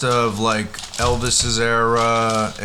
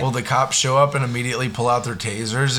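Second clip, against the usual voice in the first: usual speaking voice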